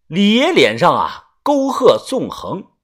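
normal talking voice

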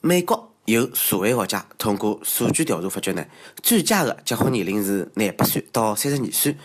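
ordinary delivery

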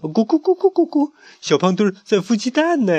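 very high, loud clucking taunt; clucking mockery. Loudmouth teasing the egg-sitting chick, high clucking. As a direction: cluck at him like a hen to mock. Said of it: "嘲笑他".

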